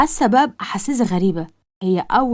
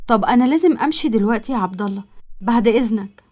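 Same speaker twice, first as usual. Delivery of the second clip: ordinary delivery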